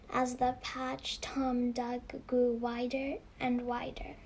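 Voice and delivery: usual speaking voice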